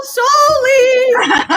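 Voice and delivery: loud sing-song call across town; yelling a name. Retold or imitated. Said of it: "run around and yell".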